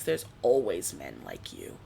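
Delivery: put-on low, slow old man; a low and slow old man, lightly voiced. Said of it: "this one old guy stands up and he goes".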